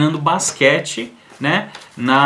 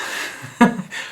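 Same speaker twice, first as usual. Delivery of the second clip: laugh; 5 semitones higher, 3 dB quieter than usual. Real, not acted. Laugh after retelling.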